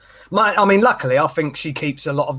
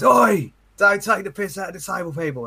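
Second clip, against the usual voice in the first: higher, shouted rebuke with a changed voice; mock telling-off; 7 semitones higher than usual. A stranger at the toilet scolding him, mock shout. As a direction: call him out sharply. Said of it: "he just turns around and goes".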